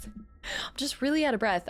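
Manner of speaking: normal talking voice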